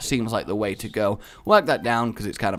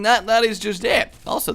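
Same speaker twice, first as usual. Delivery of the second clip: ordinary talk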